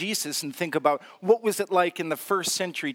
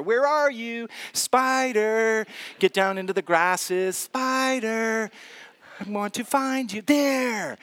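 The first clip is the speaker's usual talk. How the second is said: mock spider hunter; 5 semitones higher than usual. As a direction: play a silly seeker calling the spider. Preacher acts hunting the spider.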